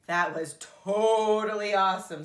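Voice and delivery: loud delighted monster voice; excited. A narrator's reading. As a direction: burst out with joy. Grover thrilled after rescue.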